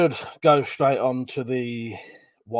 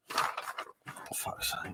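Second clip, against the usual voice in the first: very quiet, slow, whispered curse; exasperated mutter; 17 dB quieter, much slower than usual. Real, not acted. Streamer cursing to himself over a missing list, exasperated mutter. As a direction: swear under your breath.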